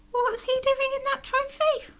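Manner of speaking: high-pitched scandalised question; gasp, then squeak in disbelief; shocked gasp. Acted. Onlooker seeing Alan in the trophy, shocked.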